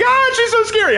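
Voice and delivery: squeaky, high mock panic; imitating friends freaking out; hammed-up fearful squeal; mock scared. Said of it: "They're all gonna be freaking out like".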